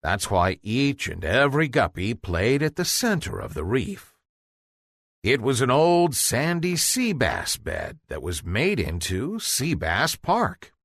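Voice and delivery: ordinary delivery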